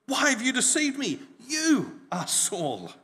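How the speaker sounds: high, loud, startled cry; shrieking woman, slowing down on the last words. Acted. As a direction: cry it out in alarm at the king. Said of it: "she cried out at the top of her voice".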